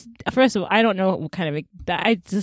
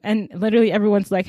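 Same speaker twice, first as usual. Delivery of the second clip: ordinary delivery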